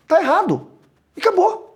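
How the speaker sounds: "Tá errado." pitched high and decisive; high, emphatic verdict. Shown as a demonstration. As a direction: repeat "Tá errado." up high to insist. ("Tá errado." dito agudo e enfático)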